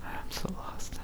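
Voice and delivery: breathy whispered aside; whispering. Real, not acted. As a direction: whisper it to yourself. Aside while Robert talks.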